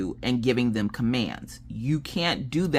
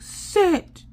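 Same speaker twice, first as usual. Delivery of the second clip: high-pitched yelled command; mock child's yell; 3 dB louder than usual. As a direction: imitate a kid yelling at a dog. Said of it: "See, you yelled at him".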